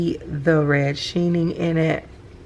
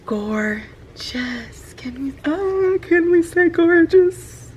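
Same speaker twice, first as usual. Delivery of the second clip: gushing; 12 semitones higher, slower than usual. Real, not acted. Gushing over the ink to viewers, a high squeal. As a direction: squeal it out with joy.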